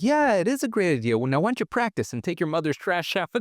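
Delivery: spring the trap with a grin; sly dad. Retold or imitated. Dad tricking the kids into taking the trash out, teasing. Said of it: "And he's like".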